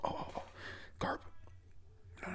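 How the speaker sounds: ordinary talk, nothing marked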